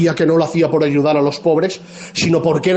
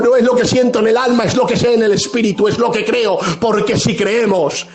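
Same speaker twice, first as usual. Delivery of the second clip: raised, driving sermon voice, building conviction; fiery preaching; 5 semitones higher than usual. Acted. Pastor exhorting the church on tears and hope, fervent preaching. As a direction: preach it out to the whole congregation. Preacher rising to full force.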